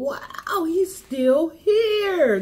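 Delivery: slow, amazed wow. Retold or imitated. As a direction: draw it out in disbelief. Quoting her amazement.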